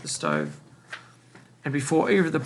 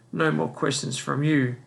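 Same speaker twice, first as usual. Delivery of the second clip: plain reading voice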